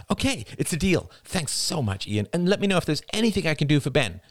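high-pitched, eager gush of thanks; excited. A narrator's reading. Rachel thanking Ian for the deal, excitedly. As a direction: thank them in a rush of excitement. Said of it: "Rachel's voice was excited".

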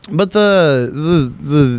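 ordinary delivery

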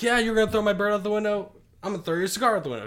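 high, loud and fast comeback; indignant retort. Retold or imitated. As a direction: fire it back at him, outraged. Angry retort to the man.

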